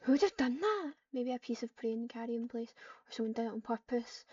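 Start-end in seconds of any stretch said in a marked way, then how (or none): 0.0-0.9 shocked outcry. Acted. Shocked cat over dead friend.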